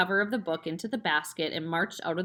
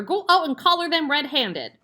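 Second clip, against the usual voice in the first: high, louder urgent command; pushy mother; 8 semitones higher, 7 dB louder than usual. A narrator's reading. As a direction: hurry him out. Mother urging husband.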